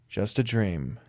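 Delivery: soft, hushed repeat to oneself; quiet echo. A narrator's reading. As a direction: let the words fall away quietly. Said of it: "It was just a dream".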